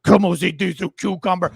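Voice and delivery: lightly hammed-up Spanish accent; Hilaria Baldwin. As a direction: put on a fake Spanish accent, mockingly. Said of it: "pretending to be Hilario Baldwin".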